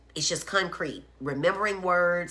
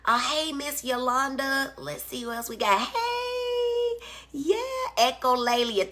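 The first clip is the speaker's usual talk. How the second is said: excited greeting; 10 semitones higher than usual. Real, not acted. Greeting live viewers.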